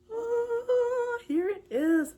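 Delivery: high thrilled oh; delighted gasp. Real, not acted. Crafter unveils finished Rory, thrilled oh. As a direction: coo at the finished plush. First sight of finished starfish.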